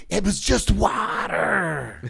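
slow, drawn-out fake crying; tearful protest, hammed up; mock wailing. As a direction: stretch it into a pitiful mock wail. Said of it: "then he starts saying".

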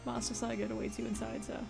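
a hint of an old butler; old man voice. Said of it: "I'm just doing old man voice".

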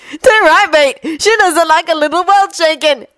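teasing lad voice. Acted. Twin voice, teasing.

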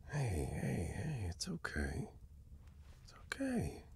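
soft whispered comfort, slow; soothing whisper. Acted. To a crying best friend, whispering comfort. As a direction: hush them gently while holding them.

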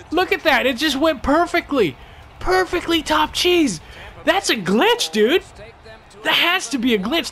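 high and loud, marvelling; excited disbelief. Real, not acted. Gamer watching the replay of his glitch goal, excited and high.

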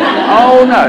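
high, loud "oh no" chorus; mock dismay. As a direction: cry out like dismayed schoolkids. Kids' dismayed chorus, imitated.

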